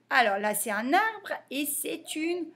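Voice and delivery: usual speaking voice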